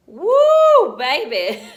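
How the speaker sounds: high, loud woo ending in a laugh; excited whoop. Real, not acted. Pregnant vlogger showing her week twenty-seven bump, excited whoop. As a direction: whoop it out big, then laugh.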